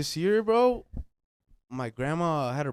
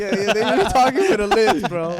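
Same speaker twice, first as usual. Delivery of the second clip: loud excited; 6 semitones higher, 12 dB louder than usual. Real, not acted. Excited retelling, laughing at lisp.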